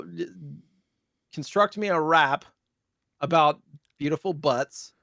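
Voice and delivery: ordinary talk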